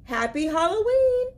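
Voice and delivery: high, loud happy greeting; cheerful shout. Acted. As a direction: call it out gleefully.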